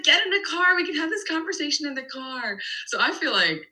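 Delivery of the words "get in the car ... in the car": mock harried parent. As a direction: hurry the kids along in playful exasperation. Retells hurrying children to the car.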